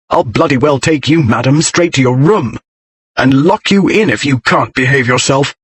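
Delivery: ordinary delivery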